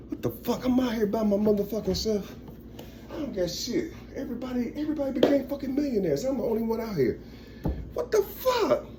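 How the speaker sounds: quiet, high-pitched acted bewilderment; plaintive disbelief. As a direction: whine softly at being left out. Acting out Jerry left alone, incredulous.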